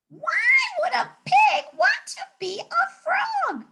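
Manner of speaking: very high squawky parrot; shrieking parrot question. Acted.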